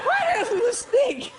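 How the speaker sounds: high squeaky laughing question. Real, not acted. Host breaking up laughing at the snake answer. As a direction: crack up mid-question.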